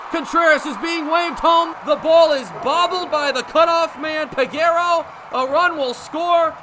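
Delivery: high, loud running call; excited play-by-play. Acted. Radio announcer calling the go-ahead run. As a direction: build with the runner racing home.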